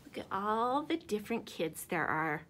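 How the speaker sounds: ordinary talk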